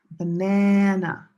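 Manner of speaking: "Banana" with a loud, drawn-out middle, ba-NAAA-na; correct stress. Shown as a demonstration. Teacher showing the real English word, "Banana" as slow ba-NAA-na. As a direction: stretch and hit the second syllable of "Banana". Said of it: "Only if you say".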